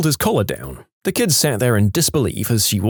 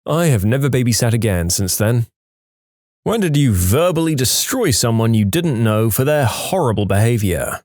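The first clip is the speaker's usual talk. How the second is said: plain reading voice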